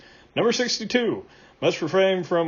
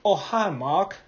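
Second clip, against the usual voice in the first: mock movie-line impression, lightly thrown off; quoting a cult film line in a put-on voice; Tommy Wiseau; slower than usual. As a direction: do a quick impression of an awkward film actor. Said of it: "character based off Tommy Wiseau".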